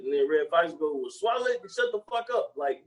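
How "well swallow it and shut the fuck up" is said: high, snapping comeback; mock grumpy old man. Retelling the orange juice scene, high mock snapping. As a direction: bite back at her. Voicing Redd Foxx reply.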